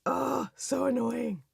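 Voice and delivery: slow, drawn-out exasperation; mock-weary sigh of annoyance; exasperated groan. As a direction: groan it out, fed up. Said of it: "You're just like".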